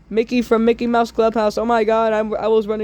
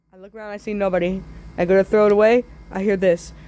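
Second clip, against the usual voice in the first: ordinary talk, nothing marked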